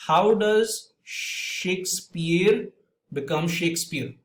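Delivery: slow, deliberate read-aloud with mispronounced words; deliberate mispronunciations. Shown as a demonstration. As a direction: read it out slowly and confidently, getting words wrong. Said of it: "with incorrect pronunciations".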